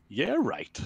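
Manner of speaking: sarcastic disbelief. Shown as a demonstration. Explaining an Irish expression, sarcastic. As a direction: say it meaning the exact opposite. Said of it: "it means the exact opposite".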